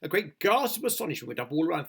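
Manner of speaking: ordinary delivery, nothing marked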